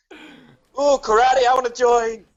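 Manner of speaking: high, eager voice for a pup; excited dog. Pretend. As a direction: pipe up loudly like a dog wanting to play.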